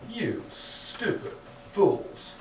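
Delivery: slow, drawn-out scornful imitation; mock hostile sneer, very slow; hostile contempt. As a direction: spell out the contempt word by word, slowly. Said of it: "it is so hostile. It says so clearly".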